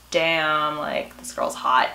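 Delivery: low, slow, understated admiration; smitten reaction. Retold or imitated. Imitating Hook seeing Emma first time, smitten. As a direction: mutter it low, as if quietly struck. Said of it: "looked at her like".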